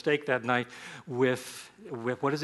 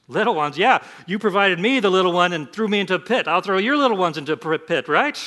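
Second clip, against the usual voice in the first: higher, louder sarcastic payback voice; mock vengeful Joseph; 6 semitones higher, 7 dB louder than usual. Imagined bitter Joseph to his brothers, hammed-up spite. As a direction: sneer the revenge he never took.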